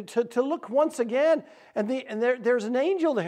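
ordinary delivery

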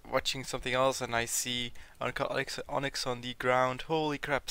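normal talking voice

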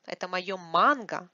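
манго stressed in contrast, brisk lead-in. Shown as a demonstration. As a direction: hurry to манго and hit it. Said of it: "Not avocado".

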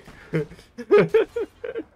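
high, loud burst of laughter. Real, not acted. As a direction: crack up loudly. Laughing after shout. (tertawa keras)

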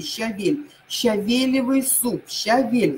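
Щавель and Щавелевый stressed on «ве»; Щавель, Щавелевый with a strong first Е. Shown as a demonstration. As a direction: hit the second syllable in Щавель and Щавелевый. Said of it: "ставится на Е".

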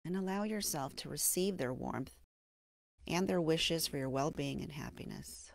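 gentle meditation guide with long, settling pauses; guided meditation. Acted. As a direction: ease the listener into self-compassion, unhurried and hushed at the phrase ends. Leads a mindfulness meditation.

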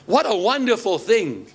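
ordinary delivery

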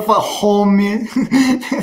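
mock Russian-accented "homie"; imitated Russian accent. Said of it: "That's how he says homie".

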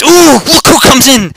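very high, loud whoop; excited callout. Pretend. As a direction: whoop loudly as someone turns up. Spots newcomer, calls out.

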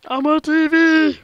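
high, slow, loud shouting; excited shout. Pretend. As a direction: yell it like a star on TV. Shouting a joke line.

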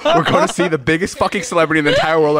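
high, half-laughing frantic scolding; stressed-out outburst. Retold or imitated. Snapping at a friend on the nervous drive, high stressed outburst. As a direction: plead for silence in a high, panicky pitch.

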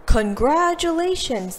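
the mayor phones Emma with big news; mayor announcing. Acted. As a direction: announce it like an official. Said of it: "the mayor announced".

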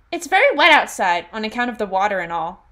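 lightly put-on character voice; Alia voice. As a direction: read the quote lightly in character. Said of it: "read them in my Alia voice".

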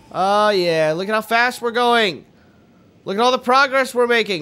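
rising, gleeful boasting; elated. Real, not acted. Player celebrating how fast they are going, elated. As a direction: cheer your own speed.

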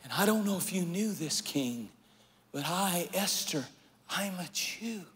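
soft, hushed confession to the king; a queen's soft, trembling plea; Esther's hushed plea. Acted. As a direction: lay it bare quietly, almost in secret. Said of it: "She said".

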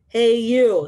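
loud, drawn-out challenging call; grouchy ladybug. Acted. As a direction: call out like a grouchy bug picking a fight. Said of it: "said the grouchy ladybug".

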